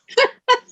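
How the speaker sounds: sharp high laugh; a quick high laugh; high burst of laughter. Real, not acted. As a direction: laugh out in disbelief. Sudden burst at clerk's reply.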